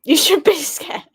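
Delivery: high, laughing tease; laughing warning. Real, not acted. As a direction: warn them while laughing. Teasing warning about show.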